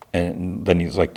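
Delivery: normal talking voice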